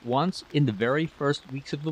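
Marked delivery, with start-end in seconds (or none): none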